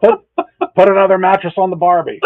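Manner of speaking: loud, high mock Australian accent; broad Australian impression, played for laughs; hammed-up Australian. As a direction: belt out a jokey Aussie catchphrase. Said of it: "you went to Australia and back".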